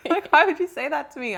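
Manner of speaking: high, loud affronted question; mock indignation. Friend objects to a messenger's comment, loudly indignant. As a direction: protest in comic disbelief. Recounting outraged reaction to rude message.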